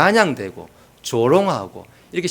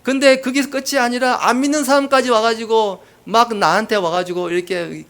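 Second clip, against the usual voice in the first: ordinary talk, nothing marked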